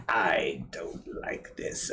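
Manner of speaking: low, slow, whispery villain; evil villain voice. Retold or imitated. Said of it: "most stereotypically evil voice".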